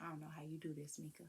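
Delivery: whispered, worn-out brother. Retold or imitated. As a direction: breathe it out like an exhausted new dad. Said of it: "he was like".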